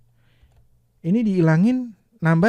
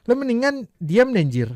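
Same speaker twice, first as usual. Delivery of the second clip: higher, louder rude retort; emotional rude demo; 7 semitones higher, 4 dB louder than usual. Shown as a demonstration. Teacher demos the variant with "njir" and an angry edge. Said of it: "nggak nambah arti".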